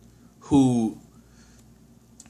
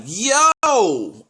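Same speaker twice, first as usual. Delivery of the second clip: higher, drawn-out "yo" of shock; retold startled yell; 7 semitones higher, 4 dB louder than usual. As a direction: relive a sudden jolt of disbelief. Retelling his shocked wake-up reaction.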